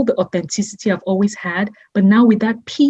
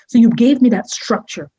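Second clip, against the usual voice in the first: normal talking voice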